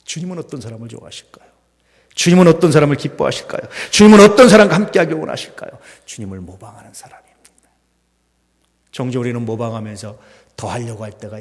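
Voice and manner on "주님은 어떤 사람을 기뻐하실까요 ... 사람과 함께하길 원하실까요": much louder and higher, building; ringing preached question. Acted. Pastor pressing the congregation, loud preached question. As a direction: call the question out to the hall.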